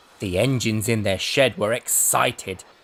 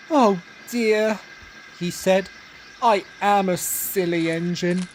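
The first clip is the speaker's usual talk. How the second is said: rueful engine voice; 6 semitones higher than usual. A narrator's reading. Engine sorry in the hole.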